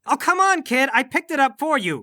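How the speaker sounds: high, exasperated pleading. Acted. Exasperated after boy drops hammer.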